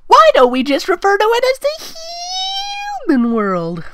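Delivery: high, loud cartoon princess, eager to help; squeaky Twilight voice. Reader voicing Twilight suggesting a name, high and squeaky.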